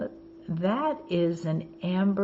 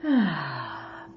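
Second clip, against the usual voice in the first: long sigh out; 2 semitones lower than usual. Shown as a demonstration. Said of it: "Take a breath".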